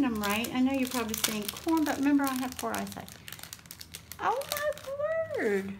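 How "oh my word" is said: high and slow, drawn out in awe; high, slow oh my word in awe; slow amazed exclamation. Real, not acted. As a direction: savor the surprise slowly. Opening a gift package.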